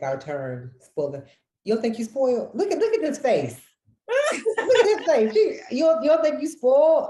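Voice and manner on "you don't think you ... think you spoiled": high, loud teasing of mom, laughing; playful teasing. Real, not acted. Daughter teasing her mother on air, high and laughing. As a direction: rib her about being spoiled, cackling.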